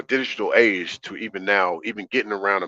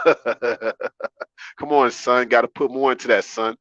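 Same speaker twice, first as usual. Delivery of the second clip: New York girl talk, lightly mocked through a laugh; laughing New York impression; slower than usual. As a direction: tease her like a brash New York chick, laughing. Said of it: "gals be talking like that, like New York chicks".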